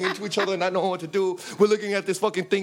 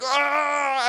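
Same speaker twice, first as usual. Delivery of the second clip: high-pitched drawn-out panicked "ah"; imitated yell of alarm; comic scream, pitched way up; mock scream; 10 semitones higher than usual. Scream while flushing the toilet.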